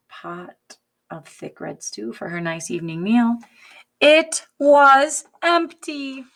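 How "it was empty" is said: loud, slow, high shocked reveal; storybook gasp of dismay; dramatic shock. A narrator's reading. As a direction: land the disappointment hard. Pot turns out empty.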